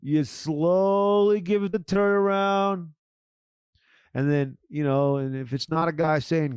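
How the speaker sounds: ordinary talk, nothing marked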